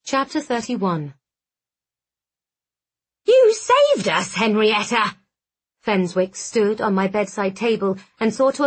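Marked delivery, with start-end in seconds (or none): 3.2-5.2 hobgoblin voice. Acted. Hobgoblin Fenswick speaks.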